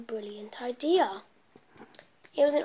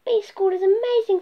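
delighted; 7 semitones higher, 6 dB louder than usual. Acted. Norman delighted.

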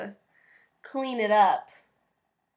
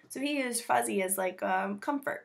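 ordinary delivery, nothing marked

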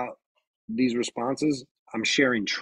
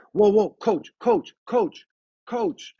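calls of coach rising ever higher; firm repeated calls; 11 semitones higher, 3 dB louder, slower than usual. Retold or imitated. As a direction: stop the coach cold, calling him over and over. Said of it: "I said coach about five times".

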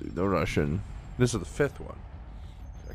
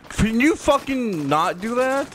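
angry yell; 12 semitones higher, 5 dB louder than usual. Real, not acted. Yells at game enemy.

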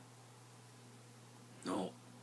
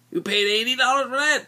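disbelieving; 9 semitones higher, 3 dB louder than usual. Retold or imitated. Buddy's disbelief at the price.

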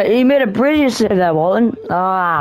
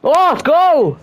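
excited urging; 9 semitones higher, 4 dB louder than usual. Real, not acted. Urging player toward goal.

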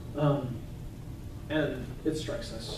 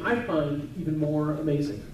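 ordinary delivery

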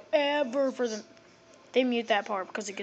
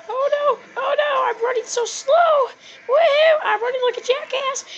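very high, loud falsetto squeal; hammed-up shrieking panic on the run; mock panic; 9 dB louder than usual. Mock panic while running in game.

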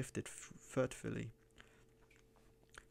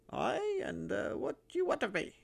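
put-on suspicious old miser in a high, cracked voice; high, croaking old miser; 13 semitones higher than usual. A narrator's reading. Narrator voicing the miser answering the stranger, high and wary. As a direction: pitch it way up like a wary old hermit.

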